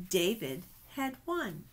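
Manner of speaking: ordinary delivery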